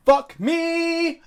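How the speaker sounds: loud, high, stretched-out curse; exasperated shout. Real, not acted. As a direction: yell it at the cards. Exasperated at bad hand.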